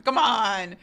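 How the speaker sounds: ordinary talk, nothing marked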